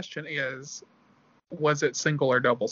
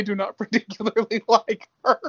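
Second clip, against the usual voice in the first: laughing mock quote; 5 semitones higher, 5 dB louder than usual. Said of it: "I thought they were gonna say".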